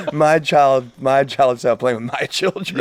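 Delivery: high, loud, laughing disbelief; cracking up in real amazement. Real, not acted. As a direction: laugh through the words, amazed at the idea. Laughing at the mind-bending idea.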